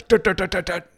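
high, quick tut tut tut of a rod bouncing; rod noise imitation. Telling a friend how the rod jumped, a high imitated rod tapping. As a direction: imitate the rod jerking as the line bites off. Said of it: "the rod goes".

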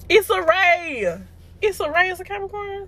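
can't believe it, pitch up high; surprised disbelief. Real, not acted. Surprised Issa Rae is Capricorn.

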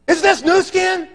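high, loud, incredulous questioning; astonished demand. Retold or imitated. Client spotting the jar on the table, acted astonishment. Retold astonished reaction to product.

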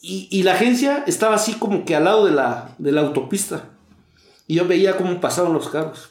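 ordinary talk

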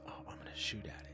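whispered quote. Retold or imitated. Quoting a gun-happy viewer.

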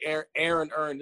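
"Aaron Earn" in Baltimore vowels, slurred toward "urn"; imitated Baltimore "Aaron Earn"; Baltimore pronunciation. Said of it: "anybody from Baltimore say Aaron Earned".

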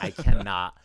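sudden loud burst of exasperation; mock crazed outburst. Podcaster sick of hearing their own voice, mock crazed outburst. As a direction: blurt it out as if cracking up. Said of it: "gonna go crazy and be like".

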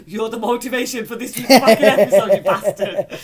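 loud, laughing through the words; laughing mock quote. Teasing co-host while laughing.

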